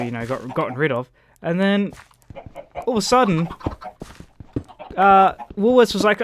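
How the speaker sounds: ordinary delivery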